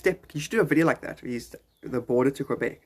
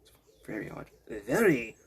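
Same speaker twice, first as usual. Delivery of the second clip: mild put-on French accent; musing in a light French accent, with pauses. Border visitor puzzled by his changed speech, mild French accent. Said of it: "it makes me speak French".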